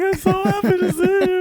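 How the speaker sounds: high squealy mock gush; gushing happy greeting. Friend gushing at the other friend last night, high squeal. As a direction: gush like a long-lost friend. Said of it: "I'm like".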